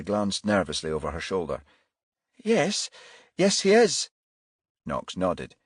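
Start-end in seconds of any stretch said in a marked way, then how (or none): 2.4-4.1 nervous. Acted. Nervous mother glancing back.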